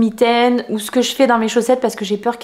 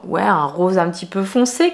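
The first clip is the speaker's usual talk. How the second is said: ordinary talk, nothing marked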